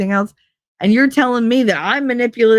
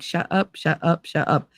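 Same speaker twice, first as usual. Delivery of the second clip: muttered shut-up; 3 semitones lower, 7 dB quieter than usual. Retold or imitated. Silencing the voice in her head.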